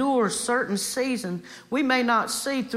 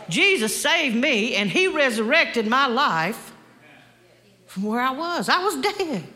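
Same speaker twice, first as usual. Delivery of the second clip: loud, raised, impassioned preacher's cry; full-force preaching; 4 semitones higher, 4 dB louder than usual. Real, not acted. Preacher urging the congregation to go to Jesus, impassioned preaching. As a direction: drive each phrase home with high, forceful emphasis.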